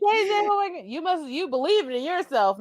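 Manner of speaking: excited. Real, not acted. Excited praise of friend.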